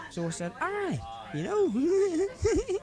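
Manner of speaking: high drawn-out cry breaking into laughter; leering laugh. Acted. Paddy delivering the punchline to his friend, laughing. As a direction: crack up at your own dirty joke.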